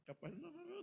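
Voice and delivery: whiny wife complaining he's never home; a pastor mimicking his wife's high nagging voice; imitating the wife. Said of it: "A esposa, ah".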